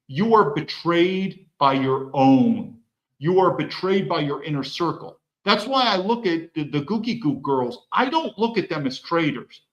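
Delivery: ordinary talk, nothing marked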